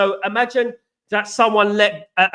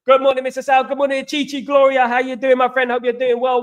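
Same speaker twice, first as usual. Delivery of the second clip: ordinary talk, nothing marked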